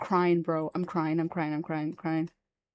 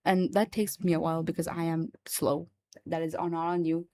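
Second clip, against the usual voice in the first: ordinary talk, nothing marked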